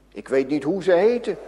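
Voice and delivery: usual speaking voice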